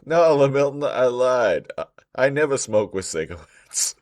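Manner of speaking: put-on high, loud frantic kid denial; panicked kid. Friend mimics scared kid in a car chase, loud and frantic. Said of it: "he's like".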